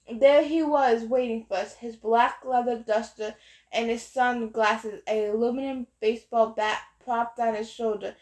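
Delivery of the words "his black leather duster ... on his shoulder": ordinary delivery, nothing marked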